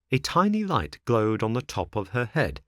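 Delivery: ordinary delivery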